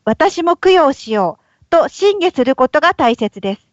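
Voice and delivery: ordinary talk, nothing marked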